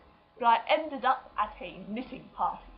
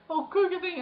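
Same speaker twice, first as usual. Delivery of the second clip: put-on prim old lady; 8 semitones higher than usual. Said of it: "they were going".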